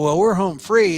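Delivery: ordinary delivery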